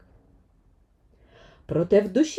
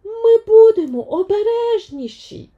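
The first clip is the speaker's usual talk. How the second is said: much higher and louder, not giving up; eager young heroine insisting; persistent Vaiana; 14 semitones higher, 7 dB louder than usual. Acted. Vaiana won't give up on Maui, high and loud. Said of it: "не здавалася Ваяна".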